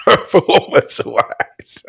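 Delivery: drawn out, high and breaking into laughter; helpless laugh on the words; laughing echo. Real, not acted. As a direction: echo it slowly through real laughter.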